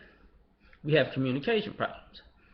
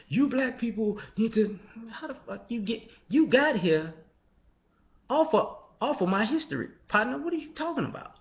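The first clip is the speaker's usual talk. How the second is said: raised, incredulous pushback; high mocking disbelief at a newcomer; mock indignant retort; 8 semitones higher than usual. Man retorting to someone from another country, high and incredulous.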